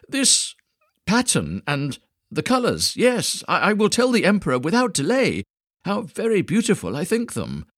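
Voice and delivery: put-on high, loud gushing old man; overpraise, flustered and eager; wise old sage.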